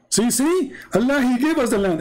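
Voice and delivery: high, excited, quickening protest; mock triumph. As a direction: imitate someone eagerly claiming to be proved right. Questioner triumphant after reading verse.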